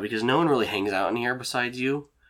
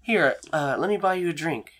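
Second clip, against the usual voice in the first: friendly woman character; 7 semitones higher than usual. Acted. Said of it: "she laughs, and, uh, she goes".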